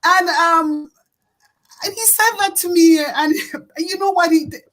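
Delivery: really moved, close to crying; getting emotional. Real, not acted. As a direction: tell it through welling tears. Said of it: "I'm getting emotional".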